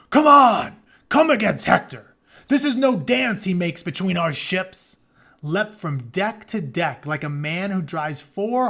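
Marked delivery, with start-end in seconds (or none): 0.1-5.0 loud, high rallying shout; rally the crew against Hector; Ajax shouting. A narrator's reading. Ajax on deck rallying his comrades, shouting. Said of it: "shouting to his comrades".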